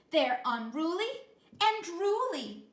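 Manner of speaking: high, loud, slow dramatic mother; dramatic Mama. A narrator's reading. Mother warning her son about dogs, dramatic. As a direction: ham up the disgust at dogs. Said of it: "she added dramatically".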